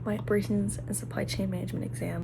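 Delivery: stressed whisper in a quiet room. Real, not acted. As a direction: whisper so nobody hears.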